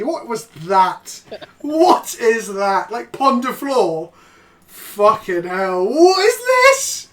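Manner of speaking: stunned disbelief. Real, not acted. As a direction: ask in stunned horror. Disbelief at pronunciation clip.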